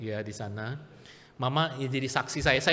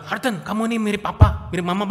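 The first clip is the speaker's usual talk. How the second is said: higher, louder cheery praise; loud cheerful compliment; 6 semitones higher, 8 dB louder, faster than usual. Retold or imitated. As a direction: say it like a proud relative. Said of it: "Bagus nggak kalau dibilang".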